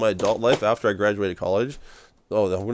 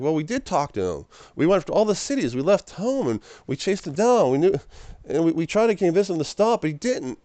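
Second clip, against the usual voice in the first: higher, defensive brother's reply; pleading brother; 7 semitones higher than usual. Retold or imitated. Brother tells Mary they tried, higher defensive plea. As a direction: explain yourself to your mother, higher. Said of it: "And he's like".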